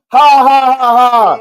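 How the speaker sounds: loud fake teasing laugh; mocking laugh. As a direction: mimic the crowd laughing at you. Imitating everyone mocking him.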